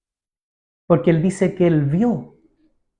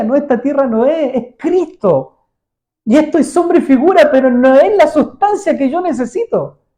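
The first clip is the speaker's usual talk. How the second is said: higher, louder, fervent declaration; Abraham crying out that he needs more; Abraham's realization; 7 semitones higher, 5 dB louder than usual. Retold or imitated. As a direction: proclaim it as a longing revelation. Abraham voiced realizing truth.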